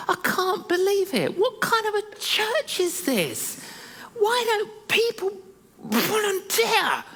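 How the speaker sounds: high, exaggerated indignant voice; indignant thought. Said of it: "sitting there really angry".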